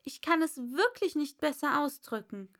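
put-on a polite young girl; polite Alice. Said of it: "erwiderte Alice sehr höflich". (höfliches Mädchen, hohe Stimme)

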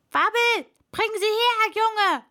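high, loud summons; commanding shout; calling out. A narrator's reading. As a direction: yell to a lad to hurry over. Worker calls to the boy.

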